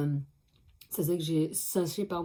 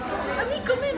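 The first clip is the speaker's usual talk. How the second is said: imitating mom. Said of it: "C'est ma mère, elle a fait".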